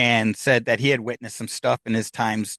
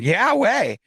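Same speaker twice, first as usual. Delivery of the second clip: higher-pitched, emphatic comeback; insistent retort; 8 semitones higher, 4 dB louder than usual. Retold or imitated. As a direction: insist against their disbelief. Friends insist after his disbelief.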